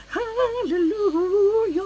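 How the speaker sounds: very high, slow, drawn-out cry of relief; relieved hallelujah. Retold or imitated. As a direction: sing out your relief to the heavens. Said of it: "I was like".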